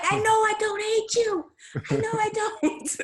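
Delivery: high, loud comic outburst; mock exasperated shout. Musician joking about being stuck inside, mock exasperated shout. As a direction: yell it way up high, playing stir-crazy.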